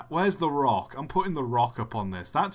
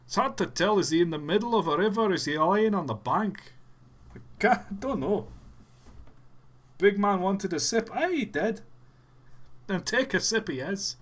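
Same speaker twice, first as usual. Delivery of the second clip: higher, lilting Scottish accent; put-on Scottish brogue; 6 semitones higher than usual. Shown as a demonstration. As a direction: play a puzzled Scot, pitched up. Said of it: "Please have a Scottish accent".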